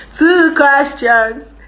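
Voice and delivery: very slow, high, loud call; drawn-out announcement. Real, not acted. Streamer calling viewers for questions, drawn-out high call. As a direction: stretch each word out high and loud.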